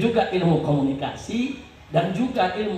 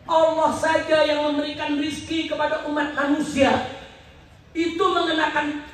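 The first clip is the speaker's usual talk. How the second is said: high-pitched, sustained oratory; raised-voice indignant sermon; impassioned preaching; 6 semitones higher than usual. Real, not acted. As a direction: preach it out to the whole crowd. Preaching about zakat and commission.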